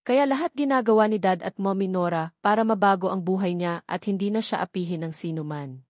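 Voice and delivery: ordinary delivery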